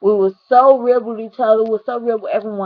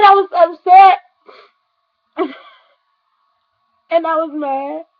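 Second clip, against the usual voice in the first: real crying; 6 semitones higher, 5 dB louder than usual. Real, not acted. Remembering her friend who died.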